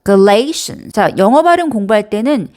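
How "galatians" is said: Galatians with LA emphasised as "lay"; stress on second syllable. Shown as a demonstration. Teacher urging learners to exaggerate the stress, Galatians stressed on LA. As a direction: lean hard on LAY in Galatians. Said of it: "강조를 하시면서 읽어보세요".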